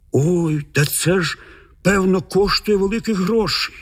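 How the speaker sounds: mildly amazed, pitch lifting toward the end. A narrator's reading. Michal marvels at how much the gem must be worth, mildly amazed. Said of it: "дивувався Міхал".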